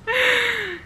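squeaky little laugh; short high laugh. Real, not acted. Laughs at own idea.

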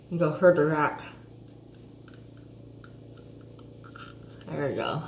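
lips pulled wide by fingers; talking with mouth stretched open. Real, not acted. Seen 1: Fingers hooked in mouth corners, pulling lips wide throughout.

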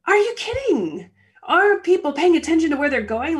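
high, loud indignant outburst at another driver; startled outrage. Retold or imitated. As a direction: yelp it like you just got cut off. Someone cuts in front, scared.

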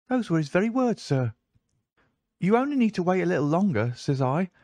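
put-on high, eager young servant boy; a breathless boy at the door; page boy voice. A narrator's reading. Page boy recounts the visitor.

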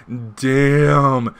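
wowed, drawn-out damn; impressed exclamation. Real, not acted. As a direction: react like the line just hit you hard. Reacting to a favourite comic line.

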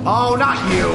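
high, loud exasperated yell; loud, high outburst of dismay; 1 dismayed shout. Real, not acted. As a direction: shout at the unwanted enemy. Enemy appears.

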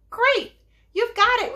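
high, loud cry of delight; storekeeper's thrilled exclamation; excited cry. A narrator's reading. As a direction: cry out as if the perfect answer has arrived. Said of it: "cried the storekeeper".

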